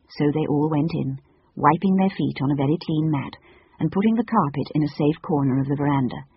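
plain reading voice

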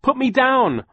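high, loud desperate yell. Acted. Violet yelling at the creature carrying her. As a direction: yell at the creature to drop you. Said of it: "Violet yelled".

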